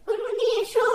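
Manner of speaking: high, loud cheer; excited. Acted. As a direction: celebrate the win out loud. Winner called and cheering. (উচ্ছ্বসিত গলা)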